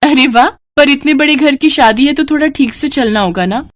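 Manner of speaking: high-pitched delight at the news; pleased excitement. Acted. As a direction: react eagerly as the pleased wife. Wife pleased at wedding invitation. (खुशी भरा उत्साह)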